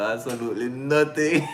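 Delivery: giggling, ending on a rise; laughing. Real, not acted. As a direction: say it while cracking up.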